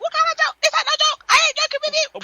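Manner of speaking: high-pitched imitation of an angry mum; furious mum. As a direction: snap back high as Mum refusing the excuse. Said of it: "She'd be like".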